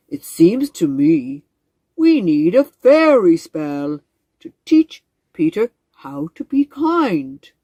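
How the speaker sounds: slow, booming, stately frog voice; a proud old frog greeting his visitors; grand wise frog. Acted. Frog voice again.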